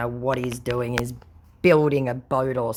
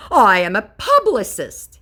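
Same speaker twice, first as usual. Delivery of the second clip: higher, louder indignant scammer; mock scammer; 8 semitones higher, 8 dB louder than usual. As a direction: protest like a caught conman. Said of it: "he tries to say".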